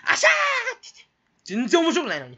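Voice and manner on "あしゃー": high squealed ashaa laugh; mock silly laugh. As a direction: squeal it like a goofy giggler. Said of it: "何にでも笑っちゃう". (甲高い変な笑い声のまね)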